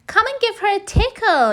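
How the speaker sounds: put-on high bright coaxing child voice; encouraging little girl. Miranda invites the scared children, eager and high. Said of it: "encouraged Miranda".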